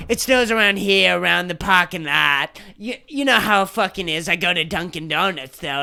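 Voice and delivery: put-on muttered Boston accent, laid back. Vlogger imitating a Boston local, a light low Boston accent. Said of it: "I gotta do the Boston accent".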